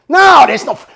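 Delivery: loud, high burst of refusal; retold outraged protest; shouted denial. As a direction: cut in shouting, refusing to believe it. Friend shouts denial in retold argument.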